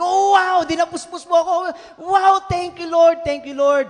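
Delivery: loud, high joyful praise; joyful exclaim. Retold or imitated. Pastor retelling her joy at the answered prayer, loud and high. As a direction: burst out thanking the Lord. Overjoyed thanks to God.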